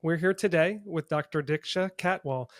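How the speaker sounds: ordinary talk, nothing marked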